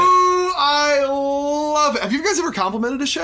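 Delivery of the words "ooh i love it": delighted squeal. Real, not acted. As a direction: squeal with joy at the joke. Delighted reaction to the pun.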